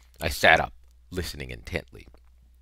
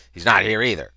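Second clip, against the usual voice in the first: louder, gruff-edged call across the room; soldier calling out; 4 semitones higher, 9 dB louder, faster than usual. A narrator's reading. Soldier searching the room tells the others, calling out loud.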